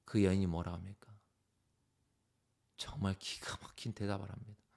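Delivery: ordinary talk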